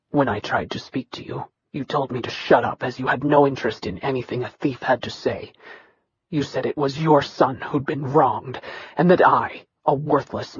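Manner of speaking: low, soft, level voice of a bitter son; dry steady Nikirian. Acted. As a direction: hold down old hurt, flat and quiet. Nikirian recalls being dismissed.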